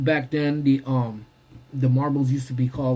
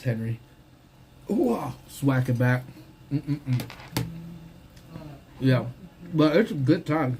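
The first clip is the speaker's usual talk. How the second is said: ordinary talk, nothing marked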